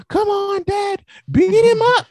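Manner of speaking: put-on high, loud squeal of a hyped kid; excited little girl. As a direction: cheer like a little girl ringside. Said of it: "she'll be hyped. Like, I'm talking excited".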